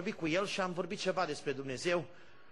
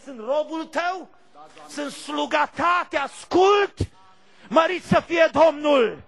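higher and louder, rising to a shout; fiery preaching; 7 semitones higher, 9 dB louder than usual. Acted. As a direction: whip up the congregation. Preaching at full force.

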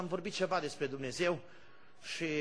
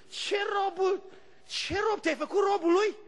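much higher, mocking disbelief; incredulous scoff; 14 semitones higher, 4 dB louder than usual. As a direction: sneer at the idea. Preacher scoffs at the servant claim.

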